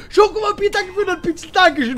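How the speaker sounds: high, excited shout-out; excited thanks. Real, not acted. Streamer thanking a donor mid-game, excited high voice. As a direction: burst out with high-pitched gratitude.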